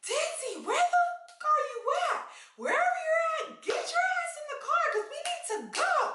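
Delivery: high-pitched frantic yell across the lot. Retold or imitated. Yelling for her friend across an empty parking lot, high and frantic. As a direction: shout for her like she's lost. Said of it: "I'm literally yelling".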